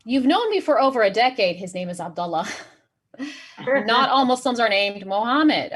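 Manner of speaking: ordinary delivery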